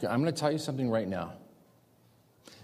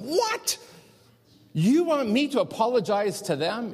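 very high-pitched mock disbelief; incredulous outburst; 12 semitones higher, 5 dB louder than usual. Man told by God to apologize, acted high-pitched disbelief. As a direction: shoot up high on the first word, then protest loudly.

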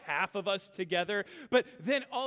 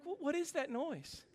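groggy bewilderment; 5 semitones higher, 7 dB quieter than usual. Retold or imitated. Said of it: "And I'm like".

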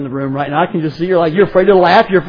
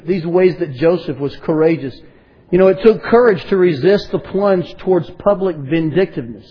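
ordinary talk

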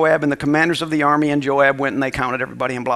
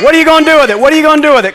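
loud, high preacher's challenge; preached question; 10 semitones higher, 9 dB louder, much faster than usual. Acted. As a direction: throw the question at the crowd.

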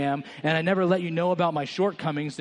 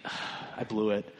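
much lower, much softer, gentle; tender apology to toddler; 8 semitones lower, 6 dB quieter than usual. Retold or imitated. Father apologizing to his three-year-old, soft and tender. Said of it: "and you think".

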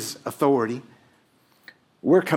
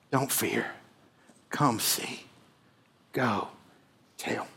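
Don't fear. Come see. Go tell. said far softer and slower; soft, hushed repeat; 2 semitones lower, 9 dB quieter, slower than usual. Shown as a demonstration. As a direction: let the triad fade almost to a breath. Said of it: "triad repeated softly at close".

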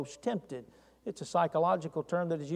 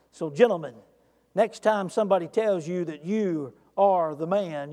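ordinary talk, nothing marked

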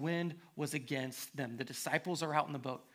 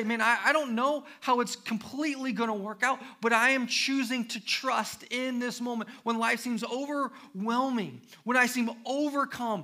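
loud, high, pleading confession of trust; impassioned testimony; 8 semitones higher, 9 dB louder than usual. Pretend. Believer sharing their story with someone, loud fervent testimony. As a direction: cry it out like your own story. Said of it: "To say".

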